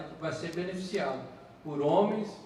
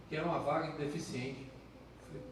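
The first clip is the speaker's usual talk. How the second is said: ordinary delivery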